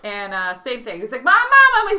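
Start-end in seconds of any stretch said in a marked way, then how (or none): 1.2-2.0 high, loud frantic calls for Mom; upset boy. Acted. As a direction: play a panicked child yelling. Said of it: "He's so upset".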